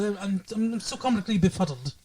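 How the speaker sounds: soft, befuddled English stammer; quiet, bumbling British impression; Hugh Grant. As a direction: mumble it like a flustered Englishman. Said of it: "Hugh Grant, you know how he's all".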